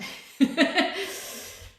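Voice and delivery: airy, high little laugh; a quick amused laugh; breathy chuckle; light laugh. Real, not acted. Laughing at viewers' speed.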